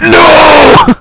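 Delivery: high, loud cry of no; mock shout. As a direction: yell it back like a rowdy class. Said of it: "and everyone's like".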